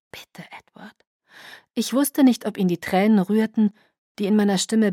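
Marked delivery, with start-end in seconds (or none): 0.1-1.0 very soft, whispered plea; hushed, smothered pleading; muffled. A narrator's reading. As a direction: beg under your breath. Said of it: "meine Stimme wurde von seiner Haut gedämpft".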